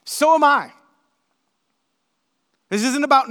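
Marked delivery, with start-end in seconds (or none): 0.0-0.7 loud, high, triumphant retort; preacher playing Paul, loud and high; proud boast. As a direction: top them with a proud shout. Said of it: "his exact wording is".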